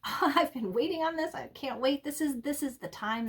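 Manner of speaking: high, fast, eager burst; imitating a thrilled seeker; very excited. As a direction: gush like the news just arrived. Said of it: "I'm getting a very excited".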